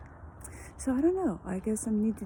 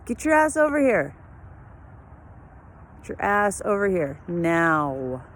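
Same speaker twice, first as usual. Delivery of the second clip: loud, higher, commanding yell at the runaway dog; angry shout at dog; 3 semitones higher, 6 dB louder than usual. Real, not acted. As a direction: bark the order so the dog comes back now. Dog chasing a cat.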